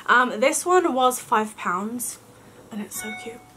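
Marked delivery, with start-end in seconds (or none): 2.7-3.4 soft unvoiced whisper. Real, not acted. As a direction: breathe it out in a whisper. Whispered aside over cute ornament.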